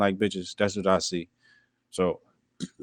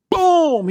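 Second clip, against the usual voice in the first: loud, high "boom" sliding down; big booming impact cry; shouted punch noise; 5 dB louder than usual. Acted. Acts out second punch landing.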